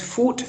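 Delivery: "food" ending in a crisp T, as "foot"; final D of "food" turned to T; contrasted final sound. Shown as a demonstration. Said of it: "food significa una cosa y food significa otra".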